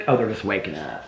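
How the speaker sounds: usual speaking voice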